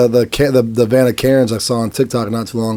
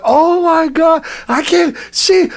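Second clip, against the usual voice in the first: mock panicked bystander; 14 semitones higher, slower than usual. Bystanders voiced in Karen story.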